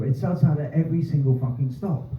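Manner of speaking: ordinary delivery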